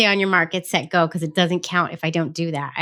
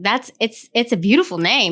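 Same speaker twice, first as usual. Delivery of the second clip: ordinary delivery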